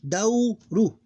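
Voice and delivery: Dow high and held, then short ru; two open syllables. Shown as a demonstration. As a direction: split Dow, ru into two beats. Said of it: "Two open syllables".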